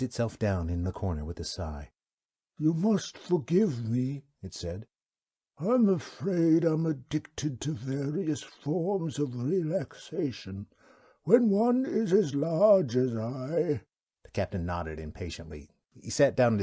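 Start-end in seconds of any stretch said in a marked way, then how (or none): 2.6-4.2 slow, high, unhurried alien creature voice; wub's voice. Acted. The wub speaks. 5.6-13.8 slow, high, unhurried alien creature voice; wub's voice. Acted. The wub speaks.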